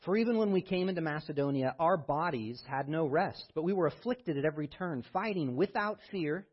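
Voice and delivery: lifted, measured reading of Paul; scripture reading. Acted. Preacher reading Paul's letter to the church, lifted. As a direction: read Paul's words with weight. Reads Second Corinthians aloud.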